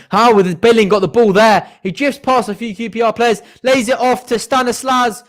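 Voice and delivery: a worried fan watching the opposition break through; alarmed commentary. Real, not acted. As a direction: sound the alarm as the danger builds.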